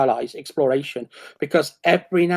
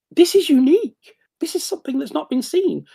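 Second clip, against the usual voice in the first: thrilled discovery; 9 semitones higher than usual. Retold or imitated. Declaring a style unique to listeners, high thrilled voice. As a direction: announce it like a treasure. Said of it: "I'm like".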